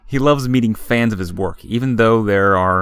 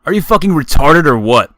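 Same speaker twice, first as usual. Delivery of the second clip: loud, higher sneering jab; mocking frat boy; 6 semitones higher, 6 dB louder than usual. A narrator's reading. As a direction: hit the insult, sneering. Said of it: "Then he says".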